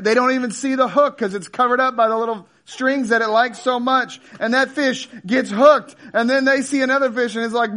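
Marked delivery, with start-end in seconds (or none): none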